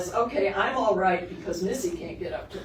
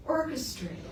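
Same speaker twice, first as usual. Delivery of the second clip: normal talking voice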